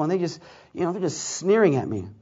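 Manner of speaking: ordinary talk, nothing marked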